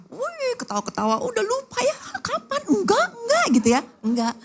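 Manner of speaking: high, sarcastic squeal; high mock laughing tone; mocking disbelief. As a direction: scoff at forgiving too fast. Mocking instant forgiveness.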